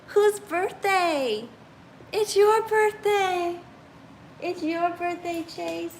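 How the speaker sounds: put-on high, slow baby talk for a pet; baby talk to dog. Talking to birthday dog.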